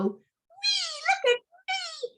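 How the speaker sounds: very high, slow squealing glee; gleeful squeal. Acted. Boy calling to his sister from the swing, high squeal. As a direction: squeal like a kid flying on a swing.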